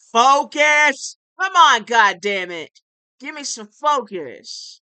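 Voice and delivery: loud angry shout for focus; loud mock-angry yell. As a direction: bark at the crowd to pay attention. Yelling at viewers to focus.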